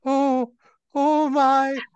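high, loud panicked gasping; an old woman suddenly seeing her hand is gone; panicked old lady. Acted. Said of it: "her eyes just get really wide".

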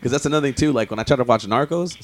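normal talking voice

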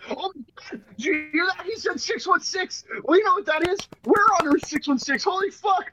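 excited. Real, not acted. Excited about a comic reveal.

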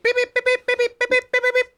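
high, rapid telegraph beeping; wire beeps. Pretend. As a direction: beep it out like an old wire machine. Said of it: "That's the wire sound".

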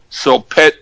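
normal talking voice

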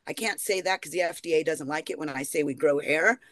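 ordinary talk

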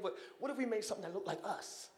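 soft, fast and conspiratorial; a hint of a musing God; casual God voice. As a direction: float the idea lightly. God voiced proposing man.

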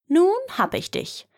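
high, gleeful gotcha; triumphant cry. Acted. The boy has trapped the old giant, triumphant high cry. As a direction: crow it out in triumph.

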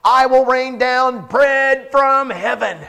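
slow, grand voice of God announcing a gift; booming voice of God. Acted. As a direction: thunder it down like God from the sky. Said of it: "here's what God calls it".

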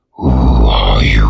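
very low, slow and loud giant spider; deep slow spider monster. A narrator's reading. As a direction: boom it out like a huge spider. Giant spider voiced in story.

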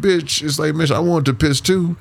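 retold annoyance. Man retelling his silent anger at his ex, mock-mad. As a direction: complain high, as if put out. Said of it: "like, just mad".

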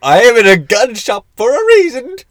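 mock angry squeak. Showing how the voice rises when angry, high and loud. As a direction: get angrier till the voice cracks up high. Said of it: "My voice gets higher as I get angrier".

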